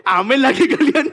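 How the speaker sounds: laughing loud and high through the words; giggling real laughter. Real, not acted. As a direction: crack up while asking for another amen.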